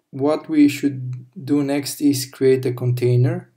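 ordinary talk

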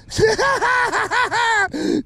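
high-pitched cackling laugh; long burst of giddy, high laughter; real triumphant laughing; elated laugh. Real, not acted. Laughing over the catch.

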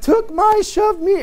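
high, loud imitation of squabbling kids; a father imitating his children tattling, high and whiny. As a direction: mimic the kids calling for mom and dad, high and loud. Kids squabbling voiced by parent.

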